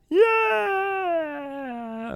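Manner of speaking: high, full-throated whoop; sudden excited yell. Real, not acted. Host cheering after announcing the episode, sudden excited whoop. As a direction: burst out with a cheer. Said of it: "I don't know why I did that".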